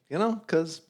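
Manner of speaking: soft, high-pitched hedging; voice getting high. Real, not acted. Co-host backing off after criticism, voice getting high. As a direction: go up high and small. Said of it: "why is your voice getting so high?".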